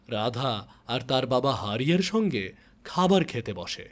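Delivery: plain reading voice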